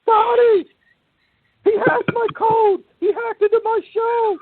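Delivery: high, strained mock rant of an angry friend; imitating Tony. Retelling the angry friend's call, high mock rage. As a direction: squeal it out like a furious man on the phone.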